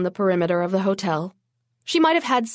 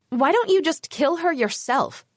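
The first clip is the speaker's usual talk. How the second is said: blurted out; 6 semitones higher than usual. Acted. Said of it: "I blurted".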